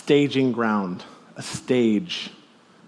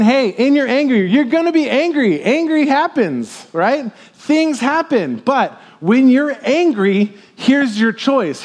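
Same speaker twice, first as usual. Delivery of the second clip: excited paraphrase; 7 semitones higher, slower than usual. Acted. Excited paraphrase of Paul's words.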